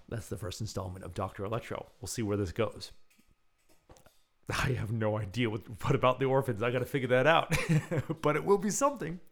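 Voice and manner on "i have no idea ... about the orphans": usual speaking voice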